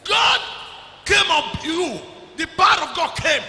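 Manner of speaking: high, loud shout. Real, not acted. Preacher shouting about the power of God to the congregation. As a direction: burst out with it at full volume.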